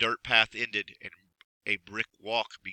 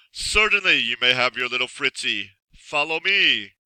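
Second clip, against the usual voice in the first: sinister magician; 7 dB louder than usual. Acted. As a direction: purr it like a villain. Magician answers Alan.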